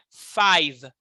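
five ending cleanly on the V, no vowel after; correct pronunciation. Shown as a demonstration. Teacher modeling the right five, ending on V. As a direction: close five on a crisp V, no "i". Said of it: "a palavra toda termina no V".

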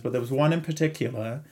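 ordinary talk, nothing marked

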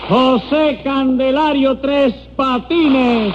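high, slow drawn-out name call; high-pitched court crier; roll call. Acted. Court clerk calling the roll.

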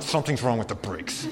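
low, muted mock grumble; grumpy reply, played quietly for laughs; retold fuming. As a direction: imitate a sulky, fuming husband under your breath. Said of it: "So I'm just fuming around".

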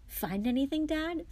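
high, keen question to his father; eager. Acted. Fred eagerly asks his tired father, rising high. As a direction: lean in, hungry for news. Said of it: "said Fred eagerly".

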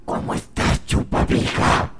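creepy unvoiced whisper. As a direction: breathe it like a demon. Possessed greeting.

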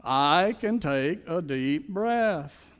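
very slow, each word drawn out; slowly, for the congregation to repeat; slow affirmation. Shown as a demonstration. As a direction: lead the room through it slowly. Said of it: "say it with me".

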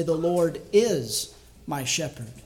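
"is" drawn out and punched, falling; a slow line that peaks on "is"; stress on IS. Shown as a demonstration. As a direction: hit the word "is" hard and hold it before "my shepherd". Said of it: "each time emphasize a different word".

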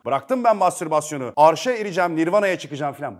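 loud, proud mock declaration; mock triumphant boast. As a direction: brag loudly about reaching nirvana. (abartılı, gururlu ilan)

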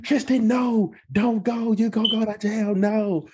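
put-on high, pleading girl's voice; friend pleading. Rose begging Tristan not to drive off, high and pleading. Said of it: "And Rose was like".

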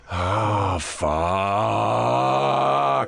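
sigh, then stretch the curse way out; hammed-up miserable groan. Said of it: "never talk to myself about good things".

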